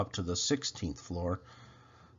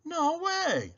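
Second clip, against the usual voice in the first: snapping. A narrator's reading. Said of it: "Jose snaps".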